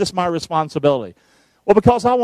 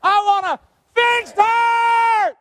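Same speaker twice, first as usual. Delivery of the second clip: high, drawn-out congregation shout; loud shout; 14 semitones higher, much slower than usual. Acted. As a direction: yell it out and get ugly. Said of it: "Can we do it one more time?".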